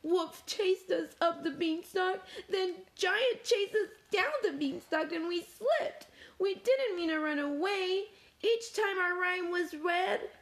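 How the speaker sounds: high, upset little voice; Spoon's storybook sobbing, lightly acted; sobbed account. A narrator's reading. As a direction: tell it tearfully in a high voice. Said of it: "Spoon sobbed".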